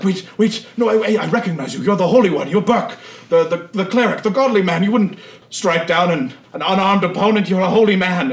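high, loud, frantic begging; terrified cultist talking fast and high; panicked pleading. Acted. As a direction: beg for your life, stammering. Cowering cultist begs for his life.

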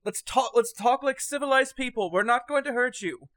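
pleading. Acted. Marco pleading with Digby to come out.

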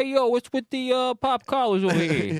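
high, loud New York tough-guy voice; New York kid mocking Florida preps; mock New York accent.

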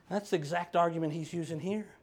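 normal talking voice

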